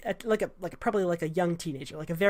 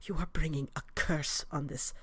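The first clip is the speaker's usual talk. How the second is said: hushed urgent whisper; 4 semitones lower, 10 dB quieter than usual. A narrator's reading. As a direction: whisper it so the healers can't hear.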